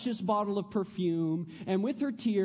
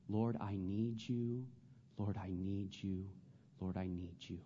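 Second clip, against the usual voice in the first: soft plea fading lower and softer; fading prayer; 9 semitones lower, 10 dB quieter than usual. Acted. Preacher praying to God, fading into hush. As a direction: let each plea fall quieter.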